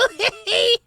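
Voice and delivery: high jeering laugh; squeaky rat cackle. A narrator's reading. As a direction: cackle at them.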